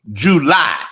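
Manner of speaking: "July" punched as JOO-LIE, both syllables stressed; "July" with swooping emphasis on each syllable; emphatic syllables. Shown as a demonstration. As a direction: hit both halves of "July" firmly. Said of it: "Put that emphasis on the syllable".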